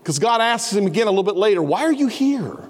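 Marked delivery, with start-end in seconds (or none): 1.6-2.6 asked higher, with weight on are; a probing question from above; God's questioning voice. Acted. God asks Elijah, higher probing voice.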